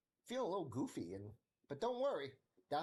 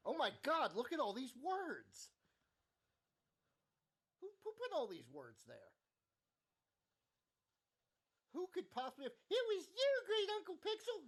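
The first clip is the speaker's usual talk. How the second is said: silly freakout. Retold or imitated. Streamer hams up panic at code, building to a high accusing yell. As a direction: play it goofy, building to a shrill blame. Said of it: "I'm being silly while I'm sitting here thinking".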